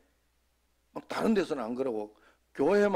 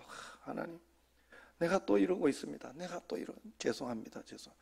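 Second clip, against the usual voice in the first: soft apologetic prayer; 9 dB quieter than usual. Retold or imitated. Retold prayer of apology mid-worship.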